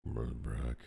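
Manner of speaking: low, gruff orc voice. Acted. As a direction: growl it like a big orc. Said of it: "be read in an orc voice".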